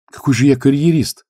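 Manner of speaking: put-on young priest proudly rejecting the charge; proud indignation. A narrator's reading. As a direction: stand up for himself proudly. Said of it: "гордо выпрямился юноша".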